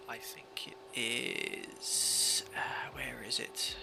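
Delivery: whispered muttering while searching; whispering. Real, not acted. Searching the FMC menu.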